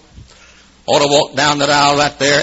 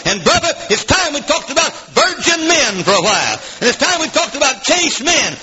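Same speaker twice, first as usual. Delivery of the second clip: high-pitched, driving pulpit delivery; impassioned preaching; 10 semitones higher than usual. Real, not acted. Preacher demanding one standard for men and women, impassioned preaching. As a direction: preach it hard in rolling, repeated phrases.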